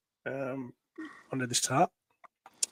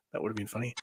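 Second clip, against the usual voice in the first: plain reading voice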